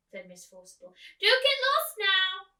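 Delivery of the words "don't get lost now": put-on high, loud fluttery old lady calling; a theatrical old actress asking after her friend. Old actress calls out to the girl, high and loud. Miss Forcible voiced high, slow.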